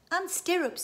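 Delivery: put-on high, loud girl's voice; child chiming in. Daughter adds to dad's list, piping up high. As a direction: pipe up like a child. Karen chips in to rant.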